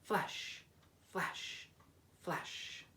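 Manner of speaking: whispered softly, fading away. A narrator's reading. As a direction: breathe the flashes like a secret. Lighthouse flashing quietly at night.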